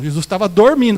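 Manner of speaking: usual speaking voice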